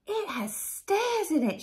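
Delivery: put-on high-pitched child's wonder; amazed gasp. A narrator's reading. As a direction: gasp at an astonishing discovery. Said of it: "she gasped".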